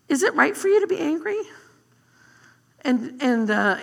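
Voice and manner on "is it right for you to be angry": pointed question. Retold or imitated. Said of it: "God says".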